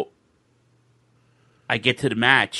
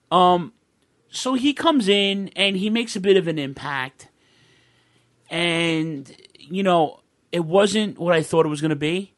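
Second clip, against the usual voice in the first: normal talking voice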